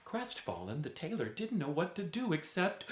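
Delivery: plain reading voice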